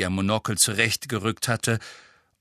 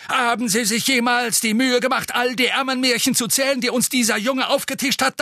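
higher, louder blustering official; blustery pompous mayor; 13 semitones higher, 4 dB louder than usual. A narrator's reading. Fudge ranting.

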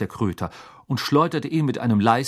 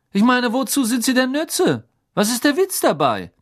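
high, louder mocking schoolboy; sneering Malfoy; 14 semitones higher, 4 dB louder than usual. A narrator's reading. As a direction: sneer at the teacher's lesson. (höhnischer Malfoy, höher und lauter)

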